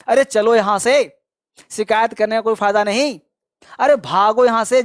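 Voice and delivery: usual speaking voice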